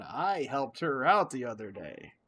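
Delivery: slow, swooping mock brag; mock boasting. Mimicking people who go around telling of their help, mock boastful. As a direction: imitate someone showing off a good deed, drawn out. Mimicking someone bragging about good deed.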